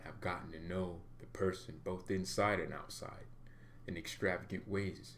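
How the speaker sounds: low, soft, hammed-up lover's voice; mock romantic proposal. A fan crooning a mock proposal, soft and low. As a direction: croon it like a cheesy marriage proposal.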